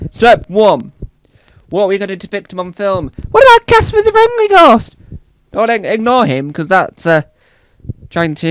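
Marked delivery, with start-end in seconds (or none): 3.3-5.0 high, loud eager pitch; excited exec. Pretend. Executive pitching Casper at the meeting, loud high voice.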